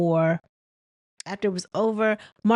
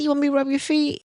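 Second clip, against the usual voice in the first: mock flirty offer; 6 semitones higher, faster than usual. Quoting Marquez's offer.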